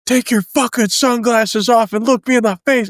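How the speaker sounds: high, commanding shout; barked order. Retold or imitated. Sergeant ordering a recruit to take off his sunglasses, high barked command. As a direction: bark the order right in his face.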